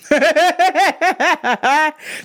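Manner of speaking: high, rapid cackling laugh; real laughter. Real, not acted. Teller breaking up at his Tommy answer, real laughter. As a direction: crack up at your own joke. Cracks up at own bit.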